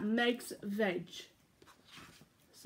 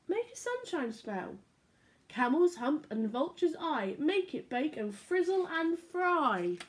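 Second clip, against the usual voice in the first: bright, lilting storybook voice; reading to children; 3 semitones higher, slower than usual. A narrator's reading. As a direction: read it like bedtime story time for little ones. Reading picture book aloud.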